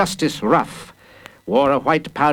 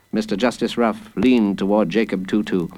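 ordinary delivery, nothing marked

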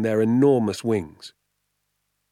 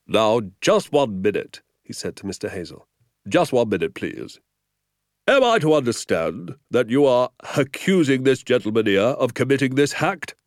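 louder, firm officer's interruption; a hint of a firm policeman; 4 semitones higher, 4 dB louder than usual. A narrator's reading. Sergeant cuts off Hazel, firm and loud. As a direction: hold up a hand and take charge.

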